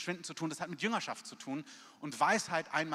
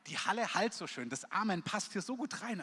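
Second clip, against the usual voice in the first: normal talking voice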